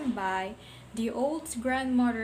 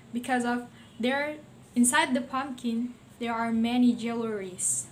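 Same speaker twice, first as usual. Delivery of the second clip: plain reading voice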